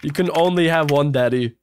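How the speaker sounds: normal talking voice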